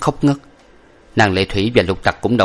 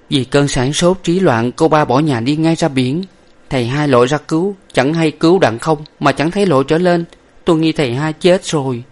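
high voice, anxious account; grieving young woman; 7 semitones higher than usual. A narrator's reading. Young lady telling the servant about the drowning, distressed. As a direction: recount the disaster in a raised pitch.

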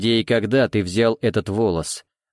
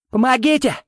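high desperate scream for help; shouting for help; 13 semitones higher, 4 dB louder than usual. Acted. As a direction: yell it out in panic. Pasha cries for help.

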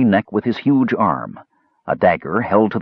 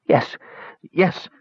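pitiful cry; 9 semitones higher than usual. A narrator's reading. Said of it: "cried the man pitifully".

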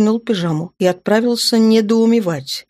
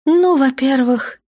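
slight smile; 8 semitones higher, slower than usual. A narrator's reading. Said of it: "чуть улыбнулась Наташа".

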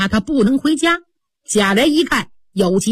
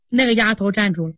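usual speaking voice

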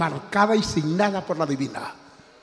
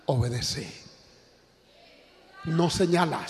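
ordinary delivery

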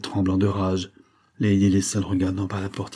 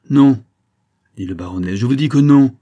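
loud, higher, flat no; shouted refusal; 5 semitones higher, 10 dB louder than usual. A narrator's reading. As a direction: bark the refusal at her.

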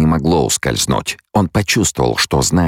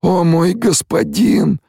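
slow, very high moaning cry; anguished moan; slower than usual. A narrator's reading. Stilgar seeing his lord's empty sockets, moaning. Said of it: "простонал Стилгар".

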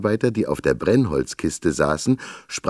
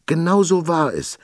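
much higher, breathless gasp; 12 semitones higher, 4 dB louder than usual. A narrator's reading. Girl in her father's arms confirms, gasping. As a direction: gasp it out in a child's voice. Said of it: "japste Torilda Josefina".

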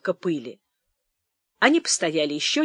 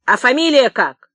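raised, forceful interrogation; loud demand; 5 semitones higher, 8 dB louder than usual. A narrator's reading. Clerk demanding the tramp's surname, loud. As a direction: demand it loudly. Clerk keeps barking questions.

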